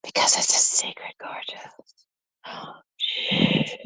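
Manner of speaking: soft secretive whisper; teasing secret whisper. Real, not acted. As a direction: whisper it to the viewer. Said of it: "Why am I whispering?".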